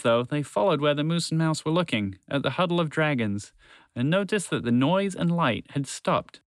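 ordinary delivery

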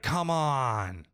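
high, drawn-out mock whine of protest; exasperated protest. Son objecting to being stopped, mock exasperated.

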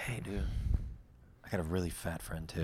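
low, very soft mock pitch; hushed aside. Pitching a friend to the comic, jokingly hushed. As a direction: murmur it like a confidential offer. Imagined quiet pitch to headliner.

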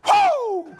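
very high, loud, ecstatic whoop; excited whoo. Retold or imitated. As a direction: holler it like an overjoyed race fan. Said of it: "That's all he had".

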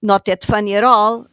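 usual speaking voice

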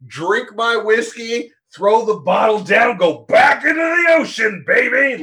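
high, loud rock and roll swagger. Acted. Hyping Aquaman's rock and roll exit, raucous shout. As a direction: whoop it like a rowdy rocker. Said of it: "it's a rock and roll".

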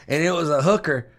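ordinary talk

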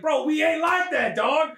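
the wife telling Arnold off; slangy brush-off. Acted. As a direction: push him away with mock street slang. Woman rebuffs Arnold.

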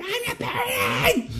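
screeching "I'm not paranoid" way up high; shrill high shriek of "paranoid"; unhinged shout. Retold or imitated. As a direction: lose it and shriek the denial. Nancy denying being paranoid.

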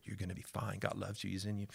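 soft, hushed reassurance; tender comfort. Retold or imitated. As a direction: murmur gently while holding a child. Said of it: "holding her and telling her".

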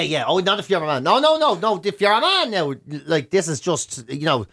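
usual speaking voice

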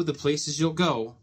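usual speaking voice

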